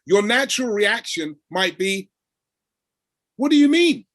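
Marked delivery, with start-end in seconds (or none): 3.4-4.0 high, louder startled question; surprised. Retold or imitated. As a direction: blurt it out, caught off guard. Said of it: "Like, surprised".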